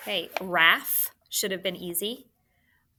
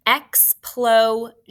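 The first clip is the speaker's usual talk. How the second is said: slow, loud, drawn-out Explosion; over-enunciated dictation of Explosion; careful dictation; 3 semitones higher, 9 dB louder, much slower than usual. Shown as a demonstration. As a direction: stretch out Explosion syllable by syllable, loud and clear. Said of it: "if you said that carefully".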